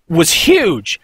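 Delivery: usual speaking voice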